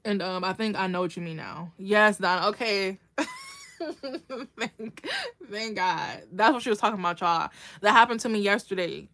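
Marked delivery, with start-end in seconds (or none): 3.2-4.5 a real, very high squeal of laughter. Real, not acted. Host laughs at misreading a viewer's comment, high squeal. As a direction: burst into a thin high giggle. Laughs at her own misunderstanding.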